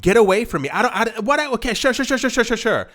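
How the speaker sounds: exasperated, half-listening dismissal of a nagging friend; annoyed brush-off. Retold or imitated. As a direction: wave off the pest with rapid fake agreement.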